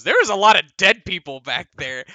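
loud, high, blunt announcement; Tybalt's voice. Retold or imitated. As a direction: blurt the grim news. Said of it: "when Tybalt goes".

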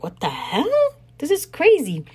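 retold appalled cry; shocked outrage. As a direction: react as if stopped in your tracks. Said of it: "I was like".

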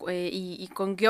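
ordinary delivery

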